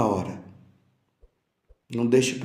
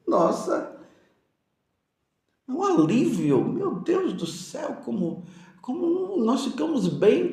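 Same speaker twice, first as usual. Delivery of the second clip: high-pitched gush of relief; relieved exclamation; 10 semitones higher than usual. Acted. Preacher acting out the relief of paying a debt, exclaiming high. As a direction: exclaim it with rising amazement.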